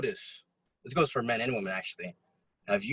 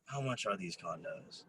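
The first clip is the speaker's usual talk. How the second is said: much softer, slow, menacing calm; Star Wars villain; 5 semitones lower, 15 dB quieter, slower than usual. Retold or imitated. Imitating the rich buyer like a Star Wars villain, soft and slow. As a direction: hush it out slow like a movie villain. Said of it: "Who here has watched Star Wars?".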